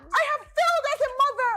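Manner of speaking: milk the betrayed mother figure; heartbroken auntie. Retold or imitated. [name] mimicking Auntie Bimbo mourning her first child, high wail.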